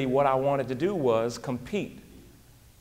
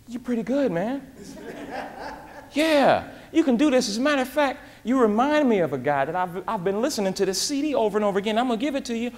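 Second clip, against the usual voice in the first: raised, eager voice full of praise; impressed coworker; 8 semitones higher than usual. Retold or imitated. Coworker Lionel praising his pitch, raised and eager. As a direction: play the coworker hyped about what he just heard.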